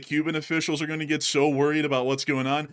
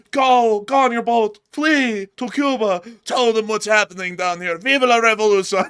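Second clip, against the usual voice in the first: high, loud hammed-up orders, stretched out on flee to Cuba; rally the messenger like a mock revolutionary; urgent command; 6 semitones higher, 6 dB louder than usual. Maduro urging the messenger to flee to Cuba, mock urgent and loud.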